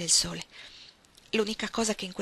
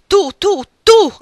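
much higher, much louder cry; shouting; 12 semitones higher, 17 dB louder, much slower than usual. A narrator's reading. Ellen crying out that Archer made it impossible, full shout. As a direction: hurl the accusation at him. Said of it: "gridò Ellen".

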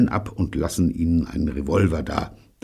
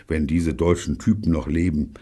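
ordinary delivery

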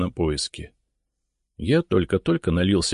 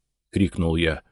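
ordinary delivery

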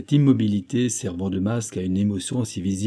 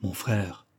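soft, hushed confession to a brother; lowered voice; 3 semitones lower, 7 dB quieter than usual. A narrator's reading. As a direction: drop the voice, confiding. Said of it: "dit Louis en baissant la voix".

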